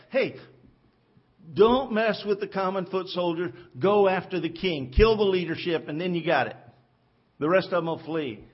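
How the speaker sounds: rally the archers, barking out the plan; a king shouting commands; commanding order. Retold or imitated. Enemy king orders his troops.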